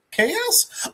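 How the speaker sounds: high, soft questioning lift; mock surprise. [name] answering the Jokers, mock surprised. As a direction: pitch it way up in feigned disbelief.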